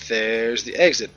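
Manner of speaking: ordinary talk, nothing marked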